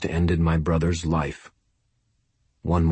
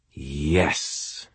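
hissed; 2 semitones lower, 5 dB quieter than usual. A narrator's reading. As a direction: hiss the yes quietly. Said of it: "Lara hissed".

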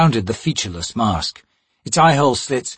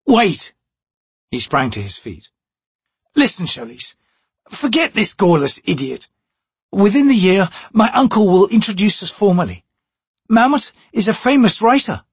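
put-on eager young thief; 9 semitones higher than usual. Crocus eager.

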